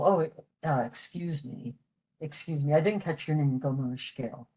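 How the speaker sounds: ordinary delivery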